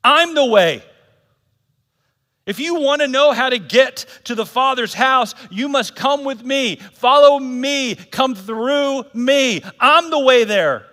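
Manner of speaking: loud, high proclamation, slowing down; proclaiming Jesus. Retold or imitated. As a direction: declare it to the whole crowd. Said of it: "He says".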